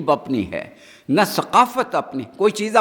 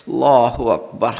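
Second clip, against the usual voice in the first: slow, low-pitched exclamation; drawn-out takbir; 5 semitones lower, slower than usual. Real, not acted. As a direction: stretch it out with solemn weight. Preacher's awed exclamation mid-sermon. (ٹھہر ٹھہر کر گہری تکبیر)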